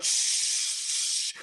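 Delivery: long voiceless hiss; mimicked jet of water; imitated water spray.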